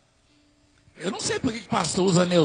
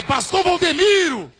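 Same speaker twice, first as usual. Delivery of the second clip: high-pitched hail across an airport; calling out; 9 semitones higher, 4 dB louder than usual. Retold or imitated. TV crew hailing the pastor at the airport, calling out. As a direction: call his name high and drawn out.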